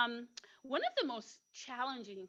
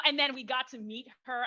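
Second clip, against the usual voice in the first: normal talking voice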